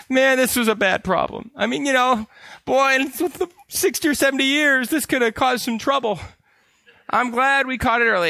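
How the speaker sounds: high-pitched, overplayed emotional relief; mock-emotional, high-voiced gratitude. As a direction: act overwhelmed at a narrow escape. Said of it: "they'll be very emotional".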